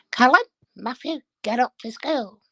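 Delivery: high-pitched parrot calling out; parrot impression. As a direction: mimic a parrot's high wake-up call. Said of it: "was that in a calm, collective voice, or was it a squeal?".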